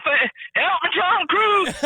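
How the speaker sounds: very high-pitched panicked cry for help; mock screaming. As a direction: squeal it in comic panic. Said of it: "I'd have been running around screaming".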